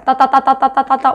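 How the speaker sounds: rapid "tta-tta-tta" clatter on one note; imitated rollercoaster climb. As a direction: mimic the ratchet of a climbing coaster. 롤러코스터 올라가는 소리 흉내. (롤러코스터 올라가는 소리 흉내)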